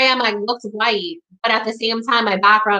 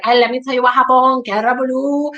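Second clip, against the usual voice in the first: imitating mom's New York Spanish accent, higher-pitched; 4 semitones higher than usual. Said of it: "a New York Spanish accent".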